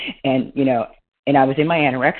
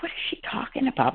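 high, bewildered inner question, fast; mock baffled, thinking it to herself; puzzled surprise; 9 semitones higher, 7 dB quieter, faster than usual. Stranger thanks her, she is baffled, surprised. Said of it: "And I'm like".

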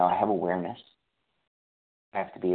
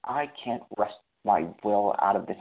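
normal talking voice

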